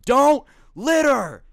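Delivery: high, loud, drawn-out yell; retold furious yell, stretched out; angry shout. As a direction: bellow it as you hurl the shake. Truck guy hurls shake back.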